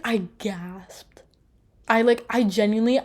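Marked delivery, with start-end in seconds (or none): 0.0-1.2 a hushed confession of shock; whispered. Real, not acted. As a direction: whisper it, still shaken. Recalling shock while reading.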